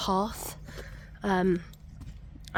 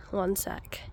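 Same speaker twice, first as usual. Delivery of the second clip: whispered aside; 9 dB quieter than usual. Real, not acted. Aside while watching dogs.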